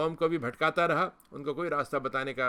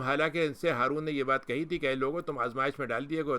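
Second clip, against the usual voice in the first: usual speaking voice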